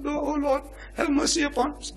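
high, soft moaning plea to God; hushed, high-pitched pleading; groaned prayer. Acted. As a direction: pray in a barely audible groan. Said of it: "not audible enough for the neighbor to hear".

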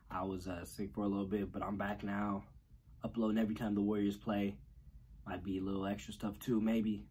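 soft, low, half-whispered update; hushed aside. Real, not acted. Vlogger apologizing to viewers for being gone, hushed and soft. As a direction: drop to a hush, like talking late at night. Quiet video break aside.

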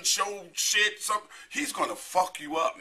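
ordinary talk

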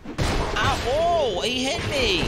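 loud, high yelp of pain; startled shout. Real, not acted. Gamer yelping at the enemy that hit him, loud and high. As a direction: cry out as the hit lands. Enemy hits his character.

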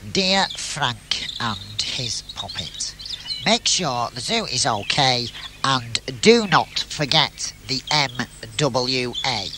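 measured letter read; reading the note aloud. Acted. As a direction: spell out the note deliberately. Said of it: "let's have a look at the note".

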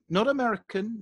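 ordinary talk, nothing marked

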